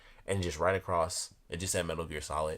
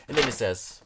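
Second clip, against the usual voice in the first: ordinary talk, nothing marked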